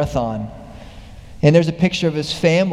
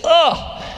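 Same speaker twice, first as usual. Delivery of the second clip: high straining grunt of lifting; effortful grunt; 12 semitones higher than usual. Retold or imitated. Old man heaving kettlebell in the snow, straining grunt. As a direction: heave the weight up with a grunt.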